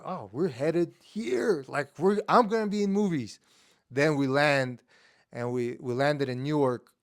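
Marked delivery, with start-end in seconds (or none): 0.0-3.4 put-on dreamy kid excitement. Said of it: "I was like".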